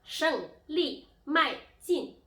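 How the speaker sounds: 胜利迈进 loud and high, each syllable falling sharply; emphatic falling-tone drill on 胜利迈进; fourth-tone demo. Shown as a demonstration. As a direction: punch out 胜利迈进 slowly, one falling syllable at a time. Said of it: "now the fourth tone".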